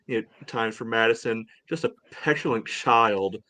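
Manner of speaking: ordinary talk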